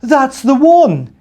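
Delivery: high, loud, slow exclaim; loud cry. Acted. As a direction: shout out in recognition. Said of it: "he cried loudly".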